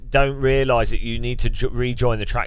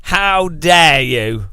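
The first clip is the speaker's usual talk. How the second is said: slow, louder fake indignation; mock outrage; 4 semitones higher, 6 dB louder, much slower than usual. Streamer scolding chat, slow mock indignation. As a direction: stretch out the offended gasp.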